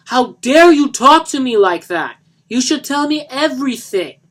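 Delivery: high, loud enraged yell, slowing down; furious yell. Acted. Johnny shoving Lisa back on the couch, furious yell. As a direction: roar it at her.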